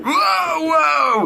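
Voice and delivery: high-pitched mock "whoa, whoa"; imitated crash yell. Gym-goer recounting the dropped weights, high imitated yelp. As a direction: whoop it out as a noisy sound effect.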